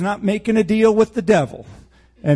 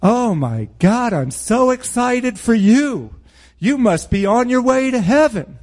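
mock excitement; 6 semitones higher than usual. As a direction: gush with exaggerated, fake delight. Mocking congratulations to half-hearted converts.

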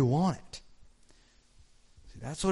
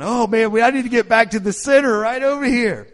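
higher and louder, an anxious lament; fretting cry; 9 semitones higher, 9 dB louder than usual. Retold or imitated. A believer who wandered away frets aloud, high and loud. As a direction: cry out as if realizing you've strayed. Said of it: "We're going".

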